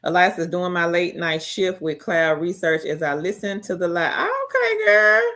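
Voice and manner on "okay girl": high excited squeal. Real, not acted. Cheering a viewer.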